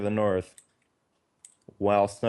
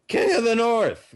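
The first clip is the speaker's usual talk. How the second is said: high, loud announcer call; 12 semitones higher, 5 dB louder than usual. Shown as a demonstration. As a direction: call the team name out big. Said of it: "announcing loadouts".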